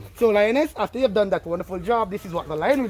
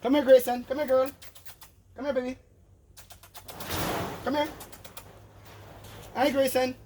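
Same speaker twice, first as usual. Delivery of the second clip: higher, soft coaxing voice; 5 semitones higher, 3 dB quieter than usual. Real, not acted. Guide coaxing baby monkey Grayson.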